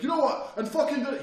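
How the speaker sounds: loud, high-pitched goading; argumentative outburst. Retold or imitated. Attacker provoking an argument downstairs, heated. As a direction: imitate someone baiting you into a row. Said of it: "He's trying to get me to argue again".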